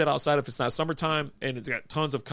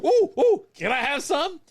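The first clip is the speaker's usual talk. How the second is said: high-pitched excited hooting, then pleading; eager mock begging; 5 dB louder than usual. Retelling spotting card handlers on the strip, excitedly begging. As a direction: imitate yourself eagerly asking, way up high. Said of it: "and I go".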